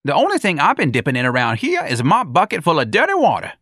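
high, animated comic voice; high-voiced denial; Jones, indignant retort. A narrator's reading. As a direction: fire back in protest, pitched high.

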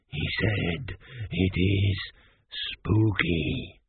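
low, halting undead croak; croaking mummy. A narrator's reading. Mummy translates for the king, in a low halting croak. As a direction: croak it out slowly, word by word. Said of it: "he croaked".

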